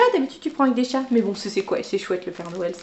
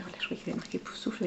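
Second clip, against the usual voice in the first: quick whispered aside to herself; muttered discovery; 3 semitones lower, 16 dB quieter, faster than usual. Real, not acted. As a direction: whisper it fast, half to yourself. Whispered aside while checking pen.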